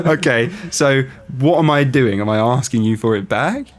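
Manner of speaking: ordinary delivery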